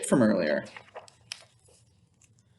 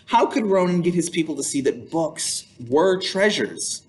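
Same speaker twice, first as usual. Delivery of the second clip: ordinary delivery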